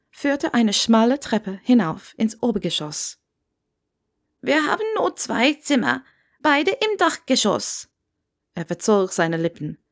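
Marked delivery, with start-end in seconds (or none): 4.4-7.9 put-on Scottish old man. A narrator's reading. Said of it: "den schottischen Akzent hören".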